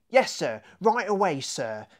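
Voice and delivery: brisk yes-sir reply, lightly voiced; eager guard. Guard answering the inspector, lightly voiced and eager. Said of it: "replied one of the guards".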